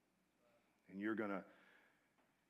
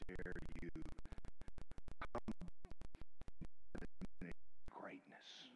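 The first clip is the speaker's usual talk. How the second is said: mocking haughty spirit. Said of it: "it goes".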